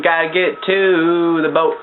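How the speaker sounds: "to" held very long before "the boat"; slow line with a long, sustained "to"; drawn-out "to". Real, not acted. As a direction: stretch "to" way out, slowly. Drawing out words while heading onward.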